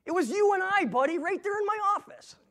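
high and loud, pleading; pleading with God. Retold or imitated. Man arguing with God over lost job, high pleading protest. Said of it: "asking God".

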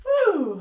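a high, loud cheering whoop; excited whoop. Real, not acted. Fitness coach cheering her class through the transition, excited whoop. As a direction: let out a big whoop mid-workout.

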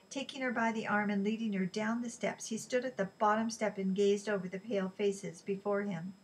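usual speaking voice

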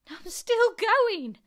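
high, loud, slow screech; old screechy voice. A narrator's reading. Grandma calls from up in the attic, old screechy voice. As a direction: screech it out, stretching every word. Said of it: "came the old screechy voice from up above".

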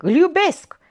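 loud high cry of love; howling. Acted. Olga howling her love at her aunt, loud. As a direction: scream it out. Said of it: "urlă".

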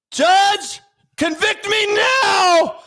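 high, loud, drawn-out begging shout; desperate mock plea. Defendant begging the judge for jail, high desperate shout. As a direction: beg the court with hammed-up panic.

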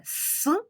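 с drawn out as a long hiss, slow; sustained ssss for children; isolated s sound. Shown as a demonstration. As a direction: hold the с sound out slowly. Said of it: "це приголосний звук".

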